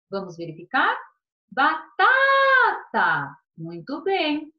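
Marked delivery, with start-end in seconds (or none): 1.5-3.3 BATATA with a loud, high, long-held middle TA; exaggerated stressed second syllable in Batata; stress on penultimate syllable. Shown as a demonstration. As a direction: call out ba-TAAA-ta, stretching the middle syllable. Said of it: "tem a penúltima sílaba mais forte".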